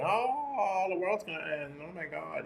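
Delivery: high, fading mock doom wail; squeaky mock panic that trails off quiet; mock panicked prepper. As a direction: imitate a prepper moaning that the world ends. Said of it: "but now he's just like".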